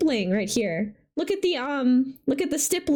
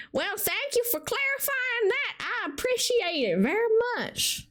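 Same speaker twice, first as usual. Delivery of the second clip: high, soft mock-polite voice; prim little thank-you in a high voice; thanking chat in a goofy high voice; silly put-on voice; 8 semitones higher, 3 dB quieter than usual. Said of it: "Don't know why I'm talking like this".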